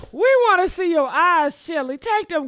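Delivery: loud teasing call at Chili. Real, not acted. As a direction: call it out like she can hear you. Calling out to Chili on screen.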